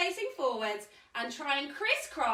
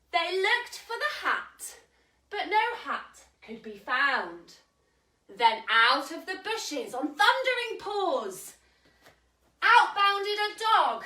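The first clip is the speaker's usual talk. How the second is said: story reading; 4 semitones higher, 3 dB louder, slower than usual. A narrator's reading. Reading picture book aloud.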